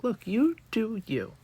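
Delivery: ordinary talk, nothing marked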